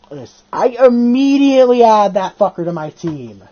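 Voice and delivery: usual speaking voice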